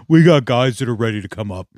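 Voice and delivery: lightly mocking a smug coach. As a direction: imitate the coach, a bit smug. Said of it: "he's laughing like a fucking douchebag".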